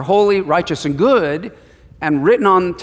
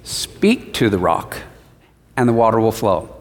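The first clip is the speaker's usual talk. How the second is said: God's gentle command; 7 semitones lower than usual. Retold or imitated. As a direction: speak it low and patient, as God. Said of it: "God says what?".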